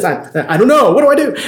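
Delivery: retold panic, voice shooting up; acted panic. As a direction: play a parent freaking out, climbing high. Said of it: "I'm panicking, freaking out on the inside".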